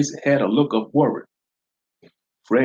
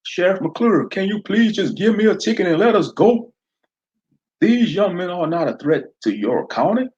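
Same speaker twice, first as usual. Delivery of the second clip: pleading driver; 9 semitones higher than usual. Acted. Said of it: "D J Charlie added".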